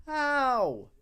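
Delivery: high, loud, drawn-out wail; loud high-pitched protest; exasperated cry. Real, not acted. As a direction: wail it in disbelief. Cries out at the lag.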